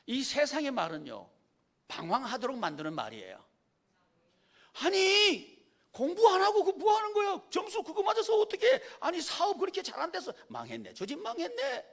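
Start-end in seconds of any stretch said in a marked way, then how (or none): 4.8-11.8 high, loud nagging neighbour voice; mocking worldly nagging. Preacher mimics the world's discouraging voices with loud high scorn. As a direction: sneer like a gossip about failure. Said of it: "이런 말을 수도 없이 듣는 거예요".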